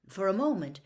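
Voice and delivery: ordinary delivery, nothing marked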